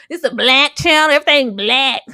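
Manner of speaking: loud mocking commenter voice. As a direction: sneer it like a hater.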